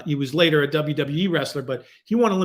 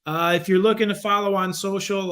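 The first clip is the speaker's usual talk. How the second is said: normal talking voice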